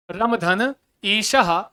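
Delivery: very slow, every syllable spelled out; slow dictation. Shown as a demonstration. Teacher repeating the example slowly for students. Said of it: "क्या?".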